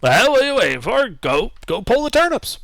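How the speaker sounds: put-on high, fast, impatient old farmer; older halfling voice. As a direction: hurry the lad along like a cranky old halfling.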